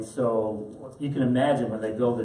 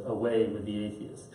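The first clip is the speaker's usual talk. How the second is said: low, quiet, dry retort with a laugh under it; calm defiant bishop; 3 semitones lower, 5 dB quieter than usual. Retold or imitated. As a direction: turn her words back on her, softly. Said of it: "puts his hand out like this and says".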